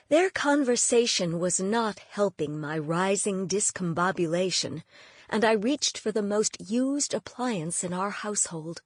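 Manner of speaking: usual speaking voice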